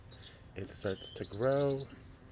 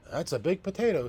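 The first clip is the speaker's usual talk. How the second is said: much higher, louder wife; wife's voice; 8 semitones higher, 6 dB louder, faster than usual. Acted. Wife marveling at the big potato, high amazed voice. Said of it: "said the wife".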